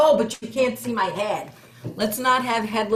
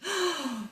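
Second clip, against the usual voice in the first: mock gasp; 7 semitones higher, 7 dB quieter than usual. Gasp before crying verse.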